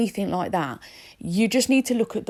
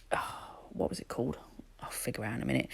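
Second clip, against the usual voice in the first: whispering; 7 semitones lower, 13 dB quieter, faster than usual. Real, not acted. Muttering to self, searching memory.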